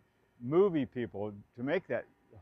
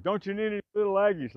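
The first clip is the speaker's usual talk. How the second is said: quicker, louder playful call; raised-voice retelling of the tease; repeated call; 7 semitones higher, 4 dB louder, faster than usual. Retold or imitated. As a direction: toss the line off again, teasing. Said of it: "like that".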